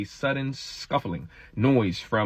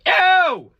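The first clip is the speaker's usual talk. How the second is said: sudden high, loud yelp; exclaimed yo; 7 dB louder than usual. Real, not acted. Reader stumped by nonsense words, exclaiming. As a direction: burst out with a high-pitched shout.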